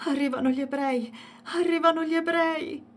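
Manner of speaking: high panicked cry, rising on the repeat; frightened crying. A narrator's reading. Dalia crying to Hasan, terrified. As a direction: sob out the alarm in terror.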